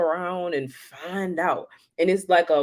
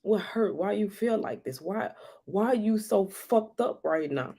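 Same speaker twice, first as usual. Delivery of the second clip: high, soft, coaxing questions; soothing self-talk; 5 semitones higher, 6 dB quieter than usual. Retold or imitated. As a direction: gently comfort yourself. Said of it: "I said".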